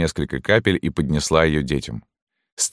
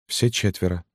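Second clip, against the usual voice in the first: small, slightly raised woman's voice; widow answering; 3 semitones higher, 4 dB quieter than usual. A narrator's reading. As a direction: confirm it meekly and more softly. (тихий ответ вдовы)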